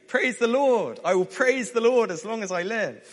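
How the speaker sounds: high, loud, jubilant psalm quote; over-the-top praise. Acted. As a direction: belt out extreme praise at full lift. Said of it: "over the top, extreme praise".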